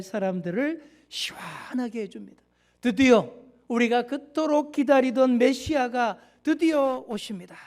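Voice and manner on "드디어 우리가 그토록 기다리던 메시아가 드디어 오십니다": proclaiming. Retold or imitated. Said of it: "하고 선포를 했어요".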